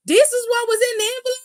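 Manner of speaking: high, fast mock disbelief; incredulous. Imitating a wrestler shown weak evidence, incredulous. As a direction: rush it out high, as if appalled.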